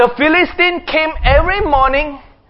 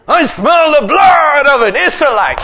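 shouted fairy-tale giant threat; giant's roar; 3 semitones higher, 4 dB louder than usual. Acted. Preacher jokingly voicing a giant's roar, shouted. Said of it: "wrong story".

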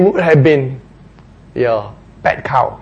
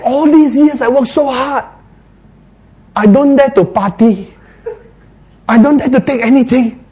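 a touch higher, hurt protest; mildly aggrieved complaint; 9 semitones higher, 3 dB louder than usual. Acted. Elder son complaining to father, mildly aggrieved. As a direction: sulk about hard years. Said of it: "And he said".

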